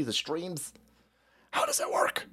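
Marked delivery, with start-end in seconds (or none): 1.5-2.3 unvoiced puzzled whisper; breathy mock-confused whisper. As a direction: whisper it, baffled. Mock confusion about streaming.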